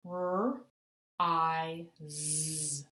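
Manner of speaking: R, I, Z tapped out one sound at a time; segmenting R I Z sound by sound; tapping out sounds. Shown as a demonstration. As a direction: sound out R… I… Z with the Z buzzed. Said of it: "Tap it with me".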